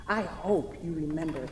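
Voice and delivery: quiet, tender voice-over reading her own letter. Acted. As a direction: speak your last letter calmly to him.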